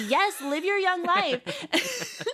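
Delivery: high, drawn-out nurse cheer; acted cheering on. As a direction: cheer a new friend on, stretching the words. Nurse cheering her on, retold.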